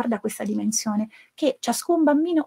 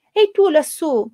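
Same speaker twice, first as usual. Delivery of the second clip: loud, higher call upward; shout; 6 semitones higher, 7 dB louder than usual. Acted. Pezzettino shouts up to the mountain dweller, loud call. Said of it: "gridò Pezzettino".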